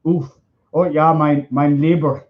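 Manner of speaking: lightly put-on German accent; comic German accent; mock German accent. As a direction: imitate a German muttering interjections. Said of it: "wait, Germans are like".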